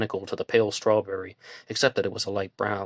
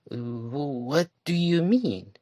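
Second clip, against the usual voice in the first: woman's voice; 6 semitones higher, much slower than usual. A narrator's reading. Woman questioning in story.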